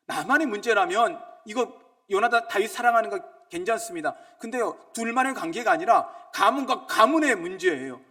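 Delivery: loud, high, insistent sermon cry; fervent preacher proclaiming; preaching at full force. Acted. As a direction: drive it home to the congregation.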